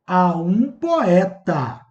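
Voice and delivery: "A um poeta" esticado palavra por palavra; título enfatizado. Shown as a demonstration. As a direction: soletrar devagar o título, alongando "um" e "poeta". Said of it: "Olha o título dele". ("A um poeta" bem lento, para ficar óbvio)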